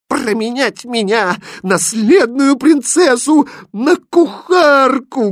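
very high, loud princess fury; furious exclamation. A narrator's reading. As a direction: shriek in wounded pride, drawing it out. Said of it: "гневно воскликнула принцесса".

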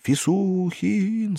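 high, slow drawn-out groaning call; slow drawn-out mournful cry; moaning call. A narrator's reading. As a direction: moan his name across the building. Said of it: "стонало здание".

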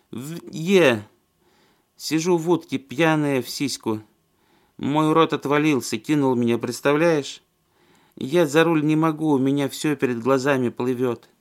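put-on slurring drunk woman, high; babbling drunk down the phone. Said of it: "Заплетающимся языком".

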